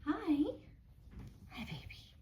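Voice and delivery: high cooing voice for the guinea pig. Real, not acted. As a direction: coo softly at your little pet.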